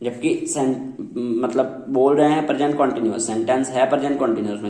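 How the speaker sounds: usual speaking voice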